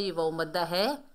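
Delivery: ordinary delivery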